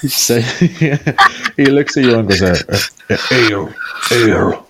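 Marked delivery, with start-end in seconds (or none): 2.4-4.7 gruff smith. Acted. GM voices burly Gormand.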